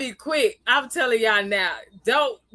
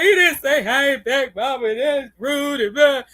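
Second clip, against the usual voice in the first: imitating little daughter; 2 semitones higher, 5 dB louder than usual. Daughter complains to mama about the old man, whiny. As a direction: play the offended little girl. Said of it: "She's like".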